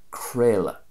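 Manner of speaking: "creala" as KRAY-la; Munster pronunciation. Shown as a demonstration. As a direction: say "creala" with an A sound in middle and end. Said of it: "I'm pronouncing it the way".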